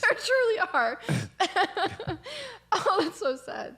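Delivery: high, slow words broken by laughing tears; tearful laughter. Real, not acted. Confirming the tears in her eyes, tearful laughter. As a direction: barely get it out while cracking up. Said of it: "she is literally crying".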